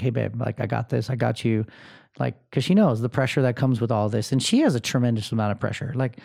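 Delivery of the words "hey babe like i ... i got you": softer, lower, tender wife's voice; gentle reassurance. Retold or imitated. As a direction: soothe him softly, like a caring wife. Wife reassuring him gently.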